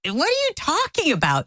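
high-pitched incredulous question; high, emphatic disbelief; shocked. Retold or imitated. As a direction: reenact being totally thrown. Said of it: "I am shocked out of my mind".

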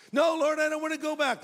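high pleading protest; Paul pleading. Acted. Paul begging the Lord not to send him back, high and pleading. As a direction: beg to stay in heaven, pitched high.

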